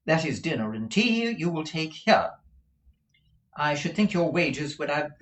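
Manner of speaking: brisk madam listing terms; businesslike Madame Mantalini. Acted.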